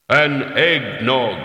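loud, slow, booming order; heavy leaden voice. A narrator's reading. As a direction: boom it out like lead striking rock. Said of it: "hitting a rock with a roll of sheet lead".

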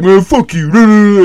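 slow, drawn-out mock tough guy; retold sneer. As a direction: stretch it out with contempt. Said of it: "he was like".

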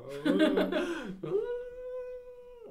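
high, loud imitation of a child crying; mock kid's wail. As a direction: ham up a little boy's crying fit. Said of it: "That's how he would cry".

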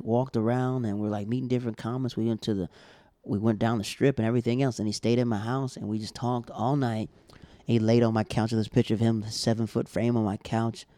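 normal talking voice

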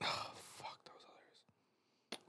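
barely audible whispered aside; spent whisper. Real, not acted. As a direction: whisper it to yourself after laughing. Winded aside after laughing.

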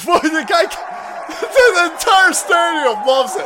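laughing and shouting high and loud; excited laughing shout. Real, not acted. Hyped viewer shouting at the clip with laughter. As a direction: roar with delight at the hit.

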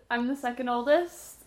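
ordinary talk, nothing marked

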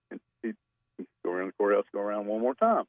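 normal talking voice

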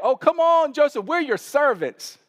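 hammed-up begging like scared brothers. Scared brothers begging Joseph for mercy, hammed-up and high. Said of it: "they're like".

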